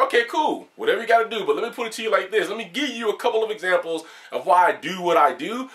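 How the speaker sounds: relaxed, friendly on-camera explanation; easygoing, not angry. Shown as a demonstration. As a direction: brush it off lightly, no hard feelings. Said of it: "But if you saw me in video".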